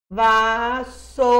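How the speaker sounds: "Vassoura" bem lento, sílaba por sílaba; soletrando devagar. Shown as a demonstration. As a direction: arrasta cada sílaba de "Vassoura". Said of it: "batendo na pauta". ("Vassoura" devagar, sílaba por sílaba)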